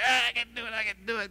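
high, strained grunting reply under the bar; mock straining yell. Preacher imitates a weightlifter yelling back while straining. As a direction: push it out like a lifter fighting the weight. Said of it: "they're yelling at each other".

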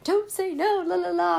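high, loud sing-song parent scolding; mock a parent telling a kid off; nagging parent. Retelling how parents scolded her as a kid, in a high mocking parent voice. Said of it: "your parents are like".

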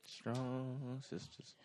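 very soft, drawn-out mock tribute; hushed solidarity. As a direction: stretch it out slowly and softly. Joins the mock tender moment.

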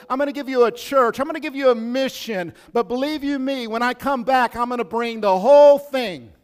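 loud, high, fervent promise; Jesus promising. Retold or imitated. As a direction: proclaim it with rising fire. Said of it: "And Jesus says".

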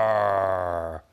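loud, full-throated lion roar; magic lion roars at the boys, loud. Acted. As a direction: roar like a big friendly lion. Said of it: "he started to say".

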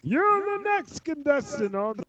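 high booming announcer voice; game show announcer. Retold or imitated. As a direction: call it like a game show host. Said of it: "goddamn voiceover thing".

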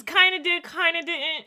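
high, singled-out "kinda" both times; high-pitched teasing hedge; playful waffling. Real, not acted. Playfully repeats own hedge back.

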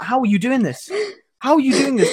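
high question, climbing further on the repeat; incredulous. Retold or imitated. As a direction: act out mounting disbelief. Acted disbelief at people in coats.

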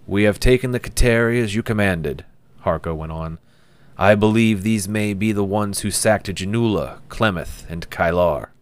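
loud, fast, brisk military report; soldier reporting. Acted. Harko reporting captured prisoners to Kite, loud and brisk. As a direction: report up the chain, loud and quick.

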